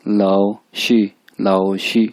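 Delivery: "शी" with a plain long ee, no r; the other way: "लाओ शी" without the r; said with shi. Shown as a demonstration. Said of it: "बाज इसको pronounce करेंगे". ("शी" बिना र के)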